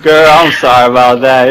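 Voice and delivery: ordinary delivery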